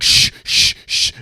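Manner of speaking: loud, sharp imitated shushes; repeated hissed shh; urgent shushing. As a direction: hush someone urgently. Retold friend hushing the woman.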